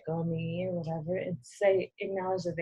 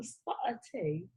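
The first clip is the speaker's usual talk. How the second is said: mock British accent; 5 semitones higher, 10 dB quieter than usual. Joins in the British accent bit.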